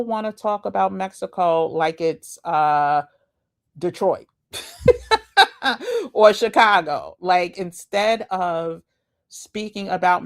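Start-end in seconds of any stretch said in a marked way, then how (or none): 4.5-6.1 squealing laugh; high laugh. Real, not acted. Laughs at Detroit comparison.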